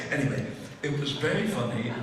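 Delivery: normal talking voice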